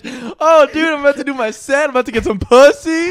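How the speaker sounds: loud, high-pitched hyped-up bragging; mock cocky excitement. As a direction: play a guy hyped about his chances. Acting out cocky comic bragging.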